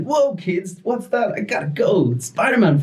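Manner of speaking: high, slow, showy superhero greeting; hammed-up Spider-Man. Storyteller greets the kids as a high, slow Spider-Man. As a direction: boom it out like a party entertainer.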